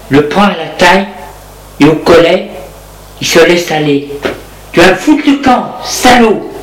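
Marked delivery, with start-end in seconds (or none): 4.7-6.5 higher, mock furious order; furious woman throwing him out. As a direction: throw him out, lightly voiced rage.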